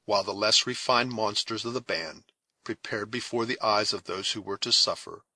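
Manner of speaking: plain reading voice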